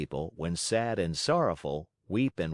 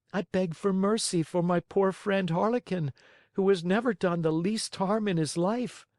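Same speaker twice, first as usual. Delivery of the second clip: much higher, begging puppet voice; pleading Pinocchio; 10 semitones higher than usual. A narrator's reading. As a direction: beg like a frightened little boy.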